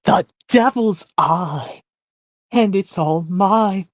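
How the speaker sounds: high, slow, gloating cartoon villainess. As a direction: savor every word like a greedy villain. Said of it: "Yep, from the rescuers".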